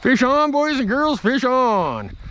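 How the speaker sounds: loud, high cry of triumph to the camera; hollering that a fish is hooked; excited call. Real, not acted. Angler calls out to viewers as fish bites, excited and loud.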